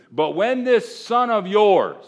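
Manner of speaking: slow and loud, with the weight on son; emphatic reading. Shown as a demonstration. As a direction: lean hard on "son of yours". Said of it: "I emphasized it when I read it".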